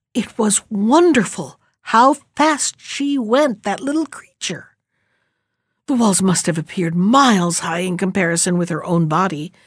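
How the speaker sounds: ordinary delivery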